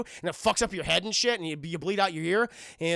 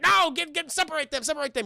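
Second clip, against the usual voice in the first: higher, urgent mock command; barked order; 7 semitones higher than usual. Lieutenant orders his men, barked order. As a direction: bark it like a thug giving orders. Villain orders families separated.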